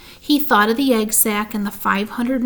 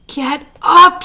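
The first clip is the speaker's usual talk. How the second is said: high, very loud furious scream; 7 semitones higher, 11 dB louder than usual. A narrator's reading. Wilbur screaming at the lazy rat to get up, furious. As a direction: blast him off the straw. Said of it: "screamed Wilbur".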